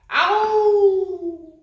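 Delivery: very high, loud howl of pain. Acted. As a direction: yelp it out as you hit the ground. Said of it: "he howled".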